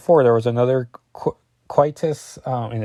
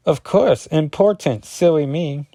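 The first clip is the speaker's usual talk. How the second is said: higher, louder put-on girlish reply; mock-apologetic Husk; 6 semitones higher, 4 dB louder than usual. Husk covers her slip to the man, higher mock-apologetic voice. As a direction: play up the fake silly-me correction.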